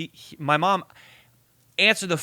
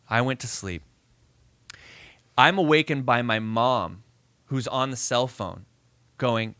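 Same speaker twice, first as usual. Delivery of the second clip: normal talking voice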